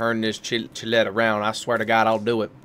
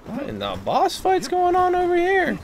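surprised. Real, not acted. Sudden high exclaim at scene.

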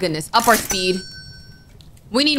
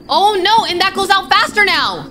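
panicked; 8 semitones higher than usual. Real, not acted. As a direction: scramble in alarm. Panic as fire fades.

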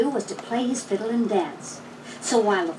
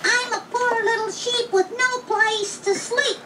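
much higher sweet lamb voice; sweet little voice; 12 semitones higher than usual. A narrator's reading. Wolf disguised as a lamb pleads at the door, sweet high voice. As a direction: coo sweetly to trick them. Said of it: "in a sweet little voice".

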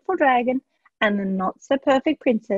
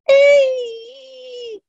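much higher, loud shriek of fright; scream; 7 dB louder than usual. A narrator's reading. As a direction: shriek like a startled queen. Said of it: "screamed the Queen".

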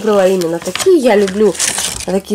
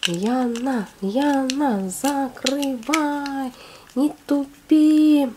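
drawn-out, higher mock scolding of herself; self-scolding; 4 semitones higher, 5 dB quieter, much slower than usual. Real, not acted. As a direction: nag yourself slowly while fiddling with the lid. Talking to herself while struggling. (протяжно, с лёгким укором самой себе)

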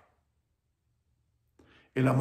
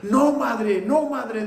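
much higher, loud protest; raised voice; 11 semitones higher, 12 dB louder than usual. Acted. Son raises his voice to stop his mother's grief, loud. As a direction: cut her off with a loud, high no. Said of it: "alzó la voz".

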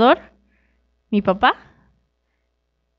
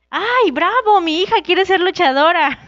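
mock cheering mother; 8 semitones higher than usual. Imagined mom cheering her daughter's wrestling dream, hammed-up joy. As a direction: play the mother gushing with pride, tongue in cheek.

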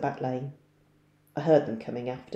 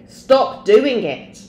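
slow, loud and much higher, each word hammered; boy grabbing his friend, angrily ordering him to stop; angry order; 8 semitones higher, 11 dB louder, much slower than usual. Acted. Grabs friend by the scruff.

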